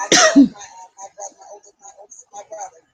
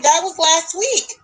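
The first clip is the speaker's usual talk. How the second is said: slow, stunned, higher; shocked disbelief; 6 semitones higher, 7 dB louder, slower than usual. Retold or imitated. Realizing the wedding photo is from last week, stunned. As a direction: say it like the floor just dropped.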